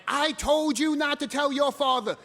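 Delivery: high, loud imitation of a screaming stepmother; retold screaming. Stepmother on the phone blaming him, high screaming scold. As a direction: scold at full pitch, imitating her. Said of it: "and she's screaming at me".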